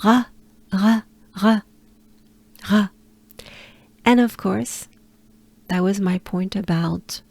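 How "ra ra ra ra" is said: Ra with a breathy throat r, tongue down; French R. Shown as a demonstration. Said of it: "So it sounds like this".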